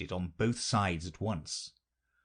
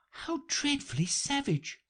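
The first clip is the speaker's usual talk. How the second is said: exclaiming. A narrator's reading. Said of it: "exclaimed Alice".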